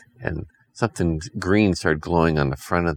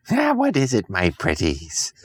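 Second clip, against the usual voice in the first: cackling witch voice; 2 semitones higher than usual. Acted. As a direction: purr it like a storybook witch. Witch answers again.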